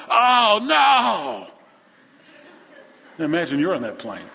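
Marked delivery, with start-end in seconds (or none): 0.1-1.5 loud, high alarmed yelp; a pilot in sudden panic; shrieked captain's "oh no"; panicked cry. Acted. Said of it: "heard from the cockpit over the microphone".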